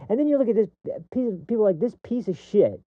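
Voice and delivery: high-pitched, hurried disgust; rising anger. Real, not acted. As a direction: spit it out, genuinely upset. Upset, turns on callous people.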